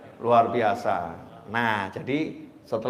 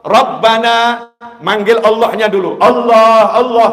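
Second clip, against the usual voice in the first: slow, high, loud invocation; drawn-out loud calls of the divine names; fervent prayer call; 8 semitones higher, 12 dB louder, slower than usual. Shown as a demonstration. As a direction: cry out to God in earnest. Demonstrates calling God in prayer.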